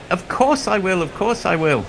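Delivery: high-pitched imitation of a fiancée; accepting girlfriend. Girlfriend accepts a filmed proposal, high mock acceptance. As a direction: say yes in a high put-on voice.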